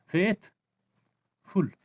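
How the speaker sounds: Faithful split slowly into फेथ and फुल, with a pause between; syllable by syllable. Shown as a demonstration. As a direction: break faithful into two separate syllables. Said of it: "faith, one syllable". (Faithful को धीरे, दो हिस्सों में तोड़कर बोलें)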